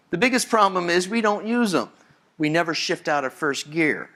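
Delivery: ordinary talk, nothing marked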